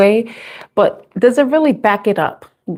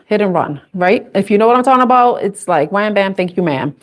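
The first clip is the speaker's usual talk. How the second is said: normal talking voice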